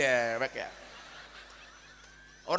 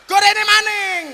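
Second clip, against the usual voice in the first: teriakan keras; 13 dB louder, slower than usual. Acted. Shouting for more food.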